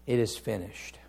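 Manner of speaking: low, soft quotation of last words; subdued and grave; hushed, solemn. Acted. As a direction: let it fall quietly, with reverence.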